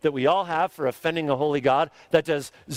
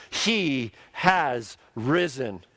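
very slow emphasis; much slower than usual. Real, not acted. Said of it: "to kind of put some emphasis on it".

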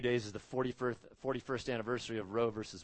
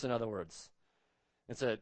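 usual speaking voice

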